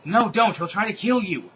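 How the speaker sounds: high, loud urgent warning; yelled warning. A narrator's reading. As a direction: shout to stop them getting in. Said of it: "so I yelled".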